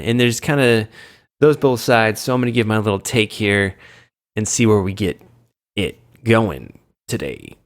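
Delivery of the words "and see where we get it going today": acting weird. Real, not acted. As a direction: stretch the words out weirdly, dropping low at the end. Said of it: "I don't know why I'm acting so weird".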